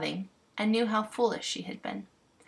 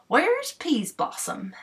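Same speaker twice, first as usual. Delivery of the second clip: higher, slow, self-important clown; pompous clown call; 8 semitones higher, 3 dB louder than usual. Acted. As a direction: call out like a proud bumpkin. Clown voiced calling fairy.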